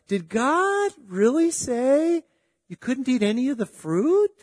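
high, slow, sly voice of the tempter; tempting serpent. Retold or imitated. The enemy questions Eve in the garden, sly and drawn out. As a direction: draw out the doubt like the serpent in the garden. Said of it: "just like the enemy did to Adam and Eve".

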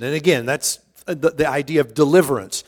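ordinary delivery

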